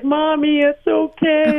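put-on high, slow little-kid reassurance; child patting mom, lightly voiced; toddler comforting. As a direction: imitate a small son soothing mom. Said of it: "He's like".

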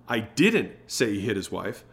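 DIDN'T punched, the rest relaxed; stress on DIDN'T. Shown as a demonstration. Sales coach shows how emphasis changes meaning, stress on DIDN'T. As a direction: hit the word DIDN'T hard. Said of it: "the emphasis that we put on the words".

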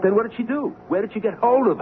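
high-pitched, exasperated questioning; outraged disbelief. Acted. Producer demanding the truth about the play, high exasperated outburst. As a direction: demand answers, voice leaping far up.

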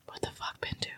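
usual speaking voice

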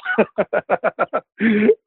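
long, high, rapid laugh; amused laughter. Real, not acted. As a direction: laugh heartily at your own joke. Amused at own joke.

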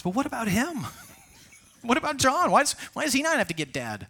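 high squeaky complaint with a laugh; whiny childish Peter. Acted. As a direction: sulk like a kid pointing at his brother. Peter whining about John.

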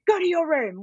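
shouted high and loud like an angry mother; half-real yell of a worn-out parent; imagined scream. Retold or imitated. Tired mum imagines yelling at her toddler, high loud shout. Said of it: "I was gonna scream".

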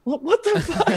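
very high, comic squeak; stammering, high-pitched shock; panicked disbelief. Retold or imitated. His panicked reaction to the call.

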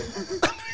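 shrill, high screeching cry; pterodactyl screech. Shown as a demonstration. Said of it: "but I'll show you".

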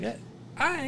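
high, soft, dopey stammer; a clueless little squeak; dumb. Retold or imitated. Teller acting his dumb look at the chief, dopey and high. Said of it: "I got my dumb look on".

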